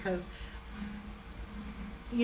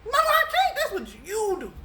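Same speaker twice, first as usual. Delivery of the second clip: quoting her younger self; 11 semitones higher, 4 dB louder than usual. Retold or imitated. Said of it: "I'm like".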